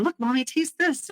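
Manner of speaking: put-on a touch of a cheerful two-year-old; toddler voice. Toddler offering mommy a pretend taste, bright little voice.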